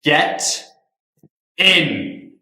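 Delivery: forceful command. Acted. Forcing her into the car.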